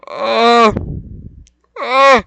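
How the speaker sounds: high, loud, stammering mock voice; hammed-up high yelling critic. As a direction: parody the armchair fan shouting at the fighter. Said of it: "I understand".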